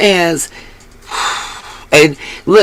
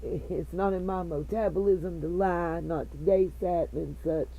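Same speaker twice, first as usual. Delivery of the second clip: muffled, like through a cloth; 19 dB quieter than usual. Real, not acted. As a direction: speak as if covered up. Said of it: "I'm sorry if I'm muffled".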